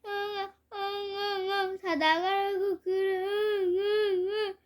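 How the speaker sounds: high whimpering sobs to his big brother; crying harder. A narrator's reading. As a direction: sob out the plea. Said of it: "울음소리는 더 커집니다".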